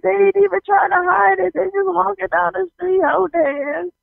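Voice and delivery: high, astonished retelling; shocked disbelief. Retold or imitated. Newcomer tells siblings about a couple holding hands, shocked. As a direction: marvel as if it were unheard of. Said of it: "so shocked that they were just".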